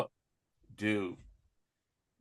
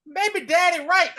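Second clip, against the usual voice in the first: squeaky son; 13 semitones higher, 6 dB louder than usual. Retold or imitated. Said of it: "[name] walked in and was like".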